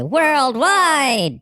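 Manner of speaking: WORLDWIDE leaps high and drawn out; extra emphasis. Acted. Reading out a promoter's sales question, extra emphasis on WORLDWIDE. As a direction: punch WORLDWIDE like it has exclamation marks. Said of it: "you added some extra emphasis on that".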